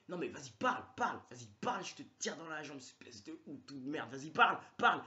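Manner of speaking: crie des ordres, menaçant et agressif; flic menaçant. Retold or imitated. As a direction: aboie « parle » comme un flic qui braque son arme. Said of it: "il était là, le mec". (flic furieux qui hurle sur le suspect)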